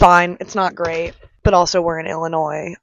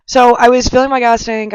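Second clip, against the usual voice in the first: ordinary talk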